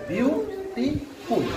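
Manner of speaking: slow teacher's syllable breakdown. Shown as a demonstration. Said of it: "এখানে তিনটি syllable আছে".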